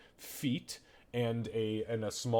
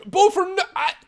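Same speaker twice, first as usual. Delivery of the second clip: high, loud desperate cry cut off; calling out; 13 dB louder than usual. Acted. Mother crying out to her son as the spell silences her. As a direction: cry out his name before the silence. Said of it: "calls out".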